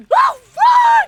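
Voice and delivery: very high, very loud shriek; startled scream. Real, not acted. Player caught off guard in the game, startled scream. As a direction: scream in sudden alarm.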